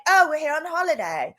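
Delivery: lightly imitated British holiday line; British accent. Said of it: "They were British".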